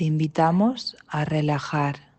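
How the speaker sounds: normal talking voice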